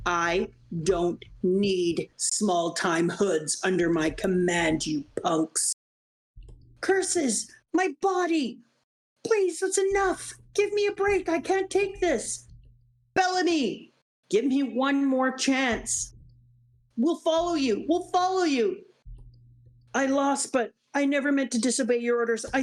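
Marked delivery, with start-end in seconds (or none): 0.1-5.7 slow, low disdainful drawl; contemptuous warlord. Acted. Warlord dismissing small-time hoods. 6.8-18.8 higher, desperate pleading cries; begging lackeys. Acted. Beaten pirates begging for mercy.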